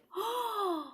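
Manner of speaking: high, drawn gasp; theatrical storybook gasp; shocked gasp. A narrator's reading. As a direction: gasp as if appalled by the plan. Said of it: "Everybody's shocked".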